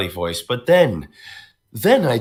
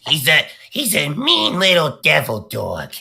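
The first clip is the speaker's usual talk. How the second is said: mean devil dog; 7 semitones higher than usual. Shown as a demonstration. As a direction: snarl it like a mean little imp. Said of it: "Zianfor sounds like this".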